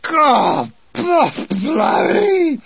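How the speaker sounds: loud, strangled howls of pain; acted cries of searing pain; agonised growl. A narrator's reading. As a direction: growl and wail through burning agony. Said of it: "followed by a growl of".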